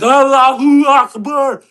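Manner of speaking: drawn-out, high mock yell; mock shout. Joking about meeting a freeloader at the airport, mock yell. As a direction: shout it slow and loud as a hammed-up joke.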